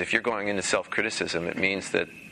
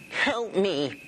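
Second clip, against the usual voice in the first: high, mournful cartoon whimper; Droopy Dog voice; 10 semitones higher than usual. Shown as a demonstration. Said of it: "I just sounded like a cartoon character".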